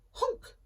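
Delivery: high honk; goose honk. Acted. Goose noise.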